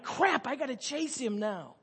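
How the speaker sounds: high, slow, mock-exasperated outburst. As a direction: groan it out, dreading the chase. Acted dismay at having to chase.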